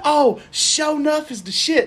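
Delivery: high, drawn-out mock enthusiasm; hyped fan quote. Everybody else raving about the movie, mock hype. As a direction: gush slowly like an over-excited fan. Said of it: "everybody else is being like".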